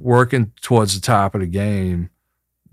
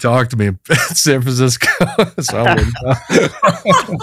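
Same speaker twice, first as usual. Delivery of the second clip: laughing; 4 semitones higher, 3 dB louder than usual. Real, not acted. Laughs through a joke about San Francisco.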